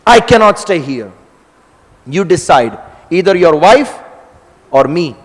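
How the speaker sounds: loud, high furious demand; angry ultimatum. Acted. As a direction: force him to choose. Balaram gives an ultimatum.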